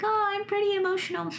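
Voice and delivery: high squeaky imitation of a clueless person; mock naive self. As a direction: play someone smugly sure they're emotional. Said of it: "and you're like".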